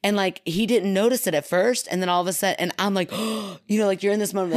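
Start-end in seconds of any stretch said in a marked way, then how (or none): 3.2-3.6 sharp intake of breath; mock terrified gasp. Teller realizes the stranger noticed, mock horrified gasp. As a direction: gasp as if caught out. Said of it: "sheer terror, but also embarrassment".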